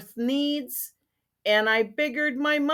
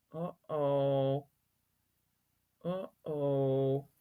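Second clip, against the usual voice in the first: worried hushed uh-oh; 7 semitones lower, 10 dB quieter, much slower than usual. Real, not acted. Storyteller's worried aside.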